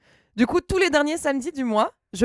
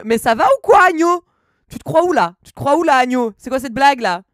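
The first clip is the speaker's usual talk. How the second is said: loud, raised-pitch indignant scolding; mock outrage; 2 semitones higher, 8 dB louder than usual. Streamer tells off chatter Agno, loud mock outrage. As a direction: tear into them in playful indignation.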